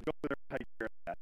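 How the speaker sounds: rattled off very fast; fast quoted order. Retold or imitated. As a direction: rush the pastor's order. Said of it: "the pastor looks at me and says".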